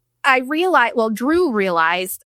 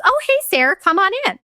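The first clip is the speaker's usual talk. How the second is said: much higher, sing-song cheery dad greeting; 9 semitones higher, faster than usual. Retold or imitated. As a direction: play the falsely breezy dad. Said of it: "And my dad goes".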